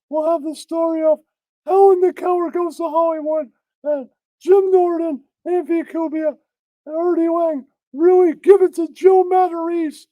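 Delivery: high, hammed-up talk-show host voice; mock news host. Host opening his spoof news show in a hammed-up voice. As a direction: camp it up as a goofy news anchor.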